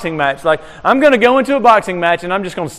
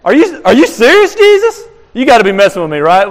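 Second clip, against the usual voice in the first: shocked disbelief; 4 semitones higher, 5 dB louder than usual. Retold or imitated. Pastor acting out his reaction to the verse, incredulous. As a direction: pitch jumps way up on What and Jesus. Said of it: "I'm like".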